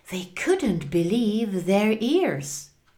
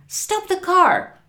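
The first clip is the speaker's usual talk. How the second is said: high, loud, urgent order; panicked shout; 12 semitones higher, 6 dB louder than usual. A narrator's reading. Frank orders the speeding car stopped, shouting in panic. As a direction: cry out to halt the driver. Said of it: "shouted Frank".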